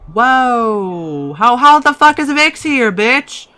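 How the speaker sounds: a high, loud drawn-out whoa, then a disbelieving yell; shocked shout. Real, not acted. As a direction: yell it like you can't believe your eyes. Shocked at Vix showing up.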